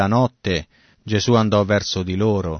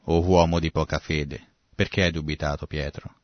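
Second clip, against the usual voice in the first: lower, softening, kindly chiding; gentle reproach; 4 semitones lower, 3 dB quieter than usual. Acted. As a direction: chide tenderly, letting the voice sink. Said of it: "i modi gentili di Gesù". (rimprovero dolce e pacato)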